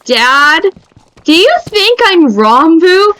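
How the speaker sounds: lightly mock disbelief; incredulous. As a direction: daughter questioning dad, lightly amazed. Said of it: "I'm just like".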